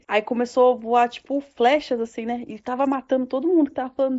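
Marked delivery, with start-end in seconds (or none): none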